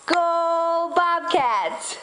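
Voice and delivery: very slow, high, drawn-out cheer; slow cheer for baby. Shown as a demonstration. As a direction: stretch every syllable for the baby. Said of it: "Can you say".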